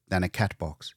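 ordinary delivery

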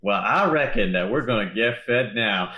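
put-on Southern accent. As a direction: lay on a light Southern accent. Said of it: "[name] just liked using Southern accents".

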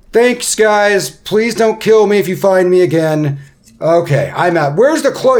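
loud, high-pitched panicky farewell; Ront, frantic to leave. Acted. Ront bids the party goodbye as he flees, loud and frantic. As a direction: blurt it loudly while making your escape. Game master plays fleeing Ront.

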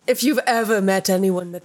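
ordinary talk

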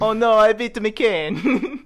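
high, loud, slow cartoon Italian plumber; drawn-out hammed-up Italian game-hero wail; Super Mario Obama. Shown as a demonstration. Joking as Obama Super Mario, high slow wail. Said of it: "doing Obama Super Mario".

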